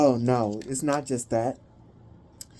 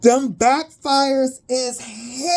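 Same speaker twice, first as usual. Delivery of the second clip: high, slow words squeezed out through laughter; barely getting it out while cracking up; cry-laughing; 6 semitones higher, slower than usual. Real, not acted. Reader to viewers about backfires, crying with laughter.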